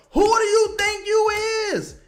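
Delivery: high, loud, outraged question; indignant challenge. Real, not acted. Fan confronting player who looked off Zion, indignant. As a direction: call the player out, voice way up.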